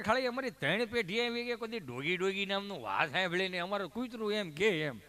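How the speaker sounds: normal talking voice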